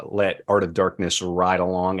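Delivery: ordinary talk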